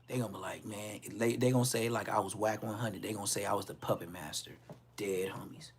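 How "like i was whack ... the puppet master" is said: ordinary delivery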